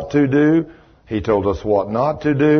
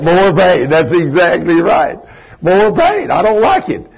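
louder, pitched up, hammering "More pain"; loud emphatic; 5 semitones higher, 6 dB louder than usual. Real, not acted. Preacher booming back to Frank with "More pain". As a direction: punch it out to the crowd. Preacher answers congregation, rising.

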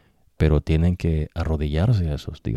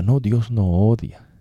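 higher, mock-innocent denial; mocking the devil's voice; 6 semitones higher, 4 dB louder than usual. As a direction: parody a deceiver brushing off the warning. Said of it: "esa es la voz del diablo".

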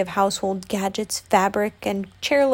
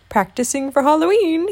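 higher, louder bear voice, amused; chuckling Pooh; 7 semitones higher, 5 dB louder than usual. A narrator's reading. Pooh chuckles between gulps of honey, chuckling. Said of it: "he chuckled between gulps".

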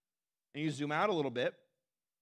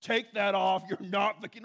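put-on loud, higher scolding of a kid; hammed-up telling-off; mock yelling; 4 semitones higher, 6 dB louder than usual. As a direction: yell it like a mean grown-up, in jest. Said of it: "nobody's yelling at seven-year-old kids".

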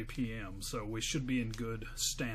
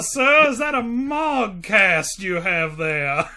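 loud, high, slow Southern "I do declare"; Southern gentleman shout; 9 semitones higher, 14 dB louder, much slower than usual. Acted. Buyer to seller, a shouted, hammed-up Southern gentleman voice. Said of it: "I do declare".

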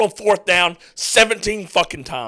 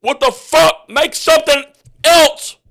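high, loud, exasperated shout at the screen; furious yell; 9 semitones higher, 5 dB louder than usual. Real, not acted. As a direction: yell at the coaches on TV. Fan yelling at play call.

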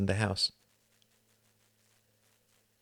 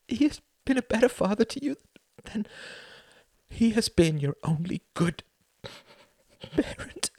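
much higher, louder, rushing words; impassioned outpouring; 4 dB louder than usual. Acted. Son crying to his mother about lost years. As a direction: pour it out with feeling. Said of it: "he cried".

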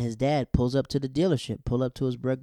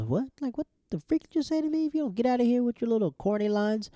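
put-on high-pitched offended girl voice; put-on high voice of outrage; mock indignant girl; 10 semitones higher than usual. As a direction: imitate a girl snapping back, high. Girl rebuffs a pickup line, acted.

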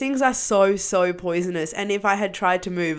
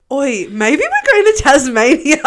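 laughing; 4 semitones higher, 7 dB louder than usual. Retold or imitated. Retold joking thought.

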